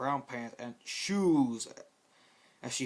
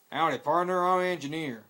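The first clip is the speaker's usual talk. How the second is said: louder, higher cowboy howdy; friendly Texan greeting; 4 semitones higher, 8 dB louder than usual. Acted. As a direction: greet her like a Texas cowboy. Engineer greets Rarity.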